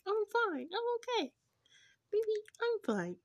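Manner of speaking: very high, put-on little voice; mock brave denial. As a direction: reassure a sweetheart in a playful squeak. Voices him denying he cries.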